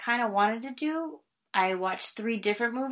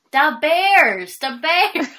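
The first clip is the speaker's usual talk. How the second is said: put-on loud, higher SNL-style Chicago accent, "Da Bears, da Bears"; mock Chicago accent; 6 semitones higher, 11 dB louder, much slower than usual. Explaining the Chicago accent to Tim, in a loud mock Chicago accent. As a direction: belt "Da Bears" like a sketch-comedy Chicago sports fan. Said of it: "trying to do a Chicago accent".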